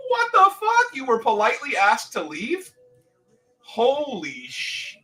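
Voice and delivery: can't believe what you're hearing; outraged disbelief. Real, not acted.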